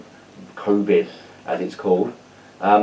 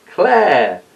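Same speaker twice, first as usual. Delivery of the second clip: excited reveal; 8 semitones higher, 4 dB louder than usual. Real, not acted. Big reveal of who played Olive.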